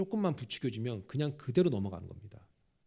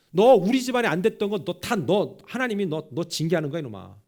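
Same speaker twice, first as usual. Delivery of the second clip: mock curse; 3 semitones higher, 7 dB louder than usual. Shimei's curse acted out.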